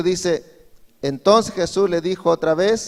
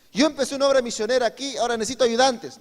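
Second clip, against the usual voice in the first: quick, higher, dismissive; lightly mocking hurried paraphrase; mock rushed version; 6 semitones higher, much faster than usual. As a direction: rattle it off fast as the wrong reading. Mocking misreading of Jesus' words.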